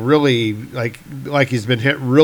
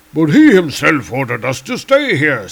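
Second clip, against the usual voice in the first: Volstagg voice; 2 semitones higher, 4 dB louder than usual. Retold or imitated. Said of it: "says Volstagg".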